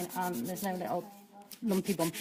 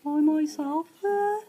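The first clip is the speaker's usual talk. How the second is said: put-on higher, slow Brummie accent; 8 semitones higher, slower than usual. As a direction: drawl it in a Birmingham accent. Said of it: "that was my little Brummie accent".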